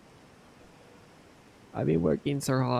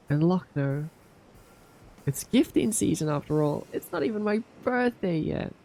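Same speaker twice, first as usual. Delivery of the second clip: hoarse sleep deprived hype; 8 semitones higher than usual. Real, not acted. Reading out gift subs, hyped.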